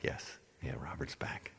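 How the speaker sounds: breathy whisper. Retold or imitated. Whispering to the crowd that Robert's back. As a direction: hush it like a secret aside.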